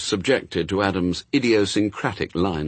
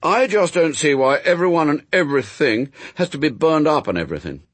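put-on high, loud, indignant boy; Adam, protesting boy; 8 semitones higher, 4 dB louder than usual. A narrator's reading. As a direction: argue like a child who finds it all unfair.

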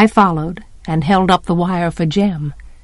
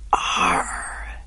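low, soft growled exclamation; soft groan of disgust; 10 dB quieter than usual. A narrator's reading. Jem lifting his foot, soft disgusted groan. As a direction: let disgust out quietly. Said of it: "said Jem softly".

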